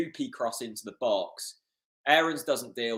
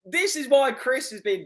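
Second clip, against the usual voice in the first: delighted; 10 semitones higher, 7 dB louder, slower than usual. Real, not acted. Fan raves about the assist.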